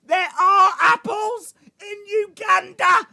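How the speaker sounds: very high, loud celebratory yell; triumphant shout. Real, not acted. Streamer celebrating a search result, triumphant high shout. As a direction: shriek it, ending on a long cheer.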